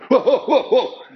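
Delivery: recoil, laughing, whoa whoa; startled whoa. Retold or imitated. The speaker swamped by two hundred messages, startled and laughing.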